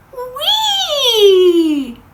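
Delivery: long high loud whee; a long gleeful slide-down whee. Acted. Drop whooshing into the dark pipe, a loud high whee.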